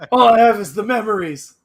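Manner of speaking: high, loud hammy sorrow; mock dramatic lament. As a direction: wail it like a soap star.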